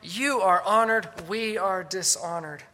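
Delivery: slow, drawn-out sarcastic contrast. Acted. Suffering apostle to the honored Corinthians, sarcastic. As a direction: lean on each contrast with mock reverence. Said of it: "his voice dripping with sarcasm".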